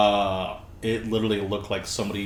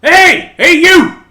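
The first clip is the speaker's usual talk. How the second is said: high, loud shouted hey; calling out; 20 dB louder, much slower than usual. Acted. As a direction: yell to get someone's attention. Acts out shouted military call-out.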